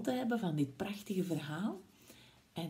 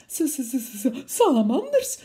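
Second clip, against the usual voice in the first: higher, stammering s-s-s snake; stuttering snake; 5 semitones higher, 6 dB louder than usual. Acted. Snake spots the Gruffalo and stammers in panic. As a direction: stammer in fright before the word. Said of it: "zei hij".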